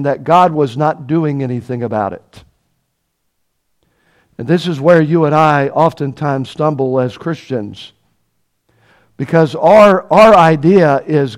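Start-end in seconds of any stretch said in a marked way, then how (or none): none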